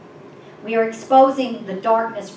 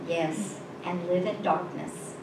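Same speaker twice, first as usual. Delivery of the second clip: low, soft, sorrowful answer; very sad; 3 semitones lower, 7 dB quieter than usual. A narrator's reading. Stranger answering the woman's question, very sadly. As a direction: answer quietly, weighed down with sadness. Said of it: "the stranger answered very sadly".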